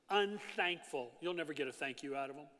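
ordinary delivery

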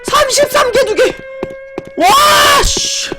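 loud, high disbelieving screams; ecstatic screaming. Real, not acted. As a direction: scream with pure joy. Record score, screaming in disbelief.

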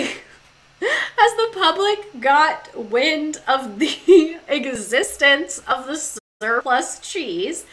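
slow, giggling reread; can't stop laughing mid-sentence; laughing through it. Real, not acted.

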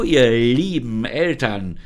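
"ihr lieben Eltern" drawn out slow and loud, sneering; mocking "dear parents". Creature sneers at the parents, mocking and slow. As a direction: lean on "lieben Eltern" until nothing in it sounds kind. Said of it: "betonte sie das „liebe Eltern".

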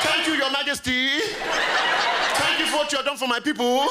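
high, mock-servile gratitude; grovelling subject. Bowing subject addressing the Queen, high and servile. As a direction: gush to royalty in a high put-on voice. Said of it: "I bowed like a slave".

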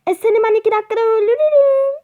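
fast, high and loud cocky boast; hammed-up bragging at the enemy; mock threat. (hızlı, yüksek sesli alaycı tehdit)

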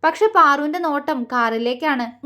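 usual speaking voice

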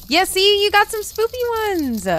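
high, squealing excitement; loud, thrilled rise in voice; excited. Real, not acted. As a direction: pitch it way up with delight. Excited at viewer's spooky items.

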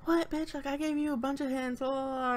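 high, quiet imitation of an annoyed friend; mock annoyed friend. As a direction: playfully mimic her scolding. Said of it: "she's gonna be like".

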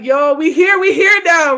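loud, high, thrilled exclamation; excited. Retold or imitated. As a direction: burst out with joy that it's finally here. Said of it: "I'm like".

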